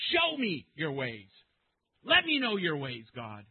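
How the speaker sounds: loud, emphatic demand falling away; imperative. Acted. Preacher as Moses demanding to know God's ways, commanding. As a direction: make each plea a bold command. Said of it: "But it's an imperative".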